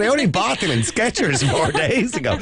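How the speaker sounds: high, laughing protest; protesting innocence through laughter; laughing disbelief. Retold or imitated. Retelling absurd airport search.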